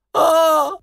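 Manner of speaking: high-pitched, short cry of Saltacavalla; high exclaimed "ah". A narrator's reading. Jester reacts to the king's rebuke, high mock cry. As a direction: let out a high little "ah". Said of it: "fece Saltacavalla".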